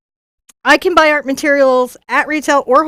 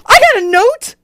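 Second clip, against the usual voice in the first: high-pitched thrilled outburst; excited squeal; 11 semitones higher, 4 dB louder than usual. Real, not acted. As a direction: squeal it with sudden delight. Thrilled at finding a note.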